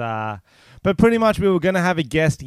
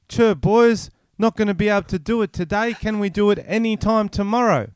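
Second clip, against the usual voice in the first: mock breezy cancel text; 6 semitones higher than usual. Reading out the guest's cancel text to listeners, mock cheery. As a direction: read the friend's bail-out message in a raised, chirpy voice.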